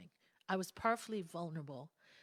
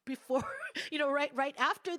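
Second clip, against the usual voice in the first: laughing through the words, pitched up; 7 semitones higher, 8 dB louder than usual. Real, not acted. Retelling having to sing right after, laughing. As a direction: break into a real laugh at the irony.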